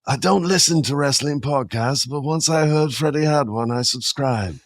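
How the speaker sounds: high-pitched British film-star impression; James Mason. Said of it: "I do a good James Mason".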